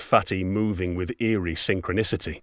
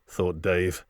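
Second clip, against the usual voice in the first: plain reading voice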